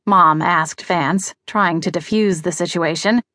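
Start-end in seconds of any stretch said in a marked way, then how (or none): none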